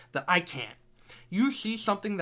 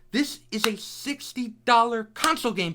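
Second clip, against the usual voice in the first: ordinary talk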